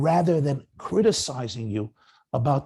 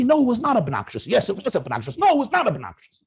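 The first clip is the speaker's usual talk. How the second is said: defensive retort; 8 semitones higher, 4 dB louder than usual. Shown as a demonstration. Defensive spouse arguing back.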